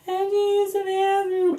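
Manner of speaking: put-on high, soft child's plea; whiny kid. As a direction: sound like a small kid who suddenly needs to go. Kid asks on the freeway.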